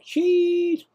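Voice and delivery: high, squeaky put-on "Cheese!" for the camera. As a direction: voice the camera snapping the photo. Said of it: "It's like".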